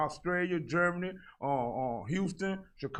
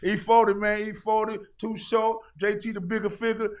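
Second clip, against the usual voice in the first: excited; 8 semitones higher, 9 dB louder than usual. Real, not acted. Hyped about Bay Area rap.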